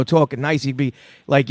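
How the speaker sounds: ordinary talk, nothing marked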